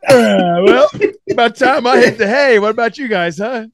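loud, high, hammed-up groan into a hint; hinting host. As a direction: play a host shooing guests off with fake casualness. Mock host hinting guests should leave.

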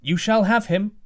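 calling out high and loud; a king's call from the castle walls. Acted. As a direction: call down from the battlements. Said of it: "called the king from the battlements".

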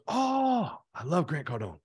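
long, high-pitched "oh" of delight; drawn-out high "oh", mock-thrilled; retold delighted reaction. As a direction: imitate a friend's excited gushing. Retold friend's delighted reaction on phone.

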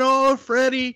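high, sweet mock reply; Miyako's voice. Wife answering Freddie on stage, sweet and high. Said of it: "She comes up and".

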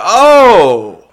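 loud, high retold "oh" of surprise; stunned surprise. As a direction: act out being bowled over by good news. Retells learning he is wanted.

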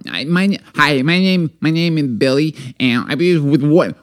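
put-on stumbling little-boy voice; baby boy. Shown as a demonstration. Small boy saying who he is, imitated baby-boy voice. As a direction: introduce yourself like a shy, halting small child. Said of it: "I'll do a baby boy".

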